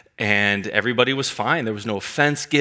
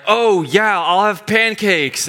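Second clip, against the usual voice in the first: higher, louder, flustered quick yes; nervous younger self; 9 semitones higher, 5 dB louder, slower than usual. Retold or imitated. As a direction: blurt the order like a nervous young man. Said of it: "But I was like".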